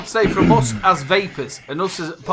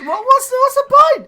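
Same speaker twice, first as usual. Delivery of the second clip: very high, loud, squealing exasperation; comic despair; 8 dB louder than usual. Real, not acted. Host squealing in exasperation at being cut off. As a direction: throw your hands up in mock despair.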